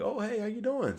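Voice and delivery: high imitation of a girl's reply; girl answering. A girl on the bus greeting back a stranger, imitated high. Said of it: "Or she may be like".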